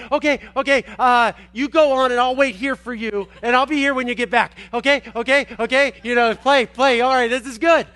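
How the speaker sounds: high, loud, eager puppy voice; eager happy dog. Retold or imitated. Preacher voicing the waiting dog's thoughts, eager. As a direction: promise to wait like a devoted dog. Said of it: "hear the thoughts of the dog say".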